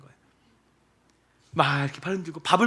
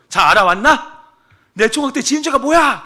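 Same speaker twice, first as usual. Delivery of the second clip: louder, raised demand; demanding pastor; 8 semitones higher, 11 dB louder than usual. Retold or imitated. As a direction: press her for the answer.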